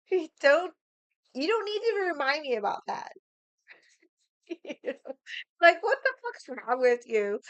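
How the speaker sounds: indignant, laughing at the start; exasperated retort. Retold or imitated. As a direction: act out being fed up and pushing back. Acted telling-off of her mom.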